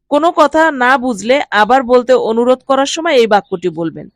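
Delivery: ordinary talk, nothing marked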